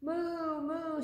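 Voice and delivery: high, long storybook moo; cow moo. A narrator's reading. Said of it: "said the cow".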